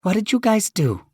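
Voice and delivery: teasing mom asking; mother voice. Acted.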